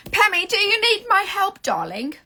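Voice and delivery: high, loud mock British accent; pretend British accent. Offering Pammy help in a hammed-up British accent. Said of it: "I don't speak British. Except sometimes I pretend".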